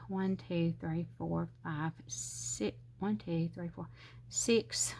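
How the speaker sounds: soft, half-whispered count; barely voiced numbers; counting under breath. Real, not acted. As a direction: count to yourself while measuring. Counting to herself while measuring.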